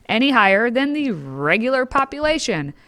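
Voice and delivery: ordinary talk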